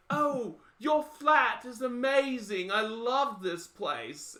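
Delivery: high, very slow mock-posh British; drawn-out, affected British delight; bad British accent. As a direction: gush in a badly faked British accent. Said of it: "they're all British".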